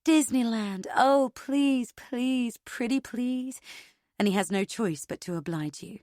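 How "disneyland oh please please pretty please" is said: high, mock-sweet begging; sugary mock pleading; mocking girly plea. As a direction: whine it like a spoiled girlfriend. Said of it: "and say".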